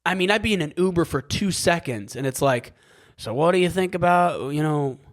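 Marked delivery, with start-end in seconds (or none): 3.2-5.0 higher-pitched, botched attempt at an Aussie accent; failed Australian accent. Uber driver quizzing an American passenger, bad Australian accent. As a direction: try Australian and land nearer British. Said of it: "That's not Australian".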